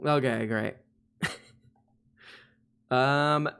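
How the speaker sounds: normal talking voice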